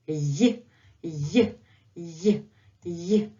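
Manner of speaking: "Y" as a tiny isolated glide; short Russian й. Shown as a demonstration. Teacher drills the short Russian letter, brief "Y" glide. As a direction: say each "Y" briefly, with gaps between. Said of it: "That's how you pronounce it".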